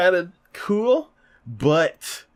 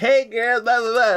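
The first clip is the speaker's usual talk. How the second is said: flirty greeter; 8 semitones higher than usual.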